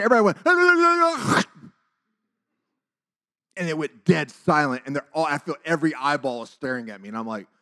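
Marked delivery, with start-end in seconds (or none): 0.4-1.7 loud, high wordless noise, cut off; imitated crowd noise. Whole school turning to the speaker, imitated noise. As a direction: mimic a noisy crowd suddenly stopping.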